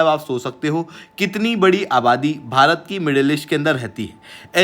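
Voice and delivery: ordinary delivery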